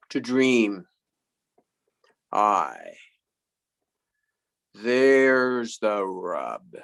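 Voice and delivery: slow stage recitation with long pauses; Hamlet soliloquy. Acted. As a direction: draw out each phrase like a grand tragedian.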